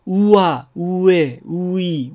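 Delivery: うわ、うえ、うい each given as a slow vowel pair; Spanish ua ue ui sounds. Shown as a demonstration. Teacher demonstrating うわ、うえ、うい to the class. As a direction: sound out うわ、うえ、うい clearly. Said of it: "で始まる単語".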